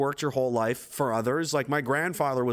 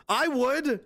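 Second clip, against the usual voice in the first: ordinary talk, nothing marked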